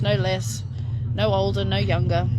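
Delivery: usual speaking voice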